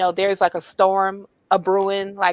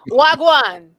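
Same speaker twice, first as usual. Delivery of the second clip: mock Jamaican greeting; 4 semitones higher, 8 dB louder than usual.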